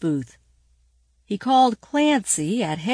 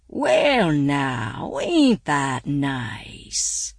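lower, very slow folksy man; street sweeper voice; 6 semitones lower, much slower than usual. A narrator's reading. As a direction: drawl it like an old sweeper.

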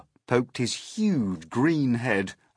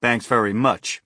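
louder, curt retort; a touch of sarcastic snap; snapped; 2 semitones higher, 6 dB louder than usual. A narrator's reading. Fire chief refusing the police chief's plan, snapped. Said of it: "snapped the head of the fire department".

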